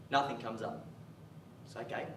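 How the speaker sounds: usual speaking voice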